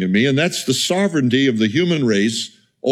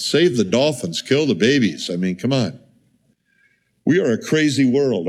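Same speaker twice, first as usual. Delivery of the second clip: normal talking voice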